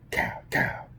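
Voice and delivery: breathy mock pow pow; whispered gun noises; whispered gunshots. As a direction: hiss out two shots. Imitating gunshots.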